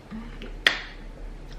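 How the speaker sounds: laugh. Real, not acted. Burning mouth from spicy sauce.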